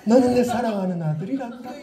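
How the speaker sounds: sugary tender voice; sweetly. Shown as a demonstration. Preacher softly doting on a boy named Hoseon. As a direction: coo it like a doting father. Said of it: "좀 더 달달하게".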